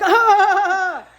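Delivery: loud, high drawn-out "ah"; mock horrified cry. Woman told to climb on small guide, loud mock-horrified cry. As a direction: cry out in comic horror.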